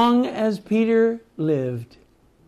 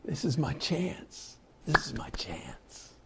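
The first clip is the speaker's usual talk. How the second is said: soft, urgent, secretive whisper; eager whisper; 2 semitones lower, 14 dB quieter than usual. Retold or imitated. Peter thinking to himself before jumping in, eager whisper.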